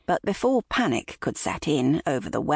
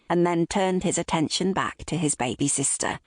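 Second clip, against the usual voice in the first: ordinary delivery, nothing marked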